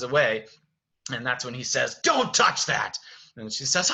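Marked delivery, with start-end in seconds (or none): 2.0-3.0 high, loud Beast scream; acted yell. Beast yells at Belle as she runs away, loud high shout. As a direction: roar the command at her. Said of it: "screams at her".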